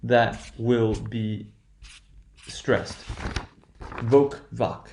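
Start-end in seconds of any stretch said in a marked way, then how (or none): 4.0-4.9 "Voc, voc": first with a long O, then a short open "vahk"; long then short vowel. Shown as a demonstration. Teacher isolating the stressed syllable, long then short vowel. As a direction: contrast "voke" with "vahk", each syllable stressed. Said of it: "you will hear a little bit of a difference".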